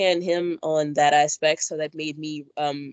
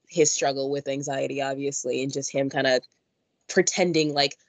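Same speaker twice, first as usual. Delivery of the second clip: ordinary talk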